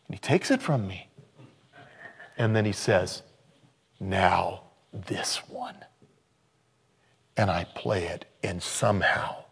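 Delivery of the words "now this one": slow, soft whisper; whispered offer. Retold or imitated. Guitar maker offering the next guitar, slow whisper. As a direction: hand it over with a hushed secret.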